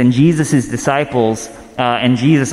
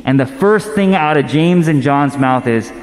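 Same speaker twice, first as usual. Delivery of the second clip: ordinary talk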